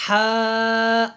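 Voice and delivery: ہا from the throat, said clearly and carefully. Shown as a demonstration. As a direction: model the throat ہا for children. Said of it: "کیا پڑھنا ہے؟". (ہا کو حلق سے دھیان سے ادا کریں)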